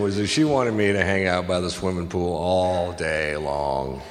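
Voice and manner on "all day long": slow, dragging, half-laughing; weary mock drag; drawn out. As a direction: stretch it out like a chore. Drawn out complaint about pool.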